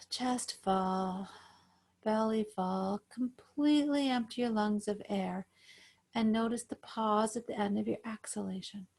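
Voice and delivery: calm, even breathing-guide voice; guided meditation. Acted. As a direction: pace each cue with the breath, unhurried.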